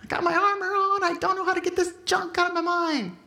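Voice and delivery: a retold anguished prayer, high and pleading; desperate prayer. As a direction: cry it out as if into a pillow. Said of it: "I started screaming like that".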